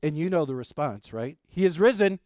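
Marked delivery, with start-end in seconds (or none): 1.5-2.2 loud, raised call; ringing Easter acclamation; congregational proclamation. Real, not acted. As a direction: call it out for the crowd to join. Leads the congregation's Easter response.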